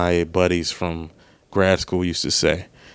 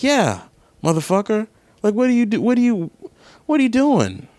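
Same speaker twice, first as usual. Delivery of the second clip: way higher, incredulous and fed up; retold exasperated rant; 13 semitones higher than usual. Retelling his answer to the parking attendant, high incredulous exasperation. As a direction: throw it at him like you can't believe him.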